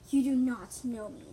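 loud, slow, deliberate retort; screaming. A narrator's reading. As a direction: shout it down word by word. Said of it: "the voice screamed".

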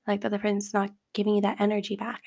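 ordinary delivery

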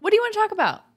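squeaky high voice; high mock voice. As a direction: pitch way up, mocking. Mocking high voice, subject dodge.

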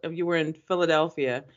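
ordinary talk

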